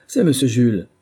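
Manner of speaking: alarmed cry; frightened recognition. A narrator's reading. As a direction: realise the policeman is at the door. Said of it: "s'écria-t-il avec un mouvement d'effroi".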